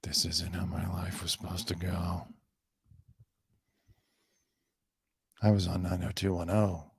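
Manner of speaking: whispered self-pity in a put-on Tori voice; mock Tori whisper. Tori moping at the motel about her lost fame, whispered. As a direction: breathe it out like a washed-up star. Voicing Tori's lament at motel.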